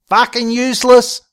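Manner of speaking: high, loud, exasperated yell; angry yell. A narrator's reading. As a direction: yell it as a parting shot. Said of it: "Sheila yelled as she slammed the door".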